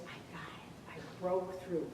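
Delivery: hushed, breathless whisper of triumph; retold kid whispering his news. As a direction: whisper it like a kid who just did it. Kid's triumphant quiet report.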